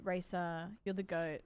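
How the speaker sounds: ordinary talk, nothing marked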